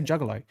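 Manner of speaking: juggalo with a short "uh" in the first syllable; juggalo, first vowel like "jug"; "jug-galo" pronunciation. Shown as a demonstration. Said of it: "I say juggalo".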